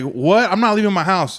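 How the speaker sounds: mock outraged refusal, voice jumping up; indignant disbelief. Retelling his refusal to police at his house, indignant.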